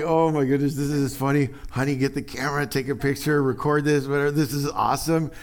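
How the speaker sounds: a parent delighted at a kid hiding badly. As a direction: gush like a parent spotting a hiding toddler. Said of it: "you're like".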